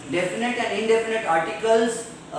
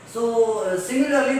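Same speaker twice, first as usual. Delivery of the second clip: usual speaking voice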